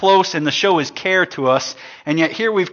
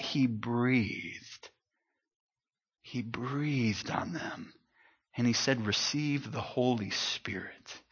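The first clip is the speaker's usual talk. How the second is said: much softer and slow, almost breathed out; hushed, breathy reading; 5 semitones lower, 13 dB quieter, slower than usual. Acted. Pastor to congregation, hushed reading of Jesus' breath. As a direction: let the words fall to a hush.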